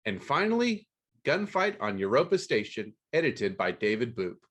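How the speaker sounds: ordinary talk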